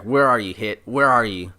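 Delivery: echoing call of a searching fighter. Retold or imitated. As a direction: shout out for a hidden foe. Goku calls out for Hit.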